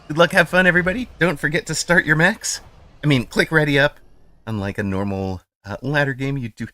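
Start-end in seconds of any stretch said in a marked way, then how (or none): 0.1-4.0 raised, higher voice to everyone; loud call-out. Real, not acted. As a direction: call out to the whole lobby. Calling out to all players.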